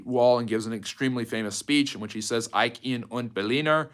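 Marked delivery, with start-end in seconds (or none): none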